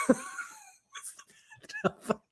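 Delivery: asked through real laughter; laughing question. Real, not acted. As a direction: giggle while asking. Laughing at a puzzling emoji.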